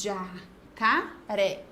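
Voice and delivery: "Jacaré" chopped slowly into ja, ca, ré; split into syllables. Shown as a demonstration. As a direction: count off "Jacaré" with gaps: ja, ca, ré. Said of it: "Três sílabas".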